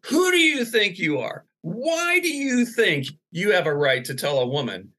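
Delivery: high-pitched indignant confrontation; high, stretched-out accusing questions, not truly shouted; mock yelling. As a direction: imitate an outraged woman demanding answers. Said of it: "she's yelling at me".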